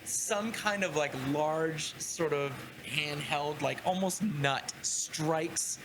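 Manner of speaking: usual speaking voice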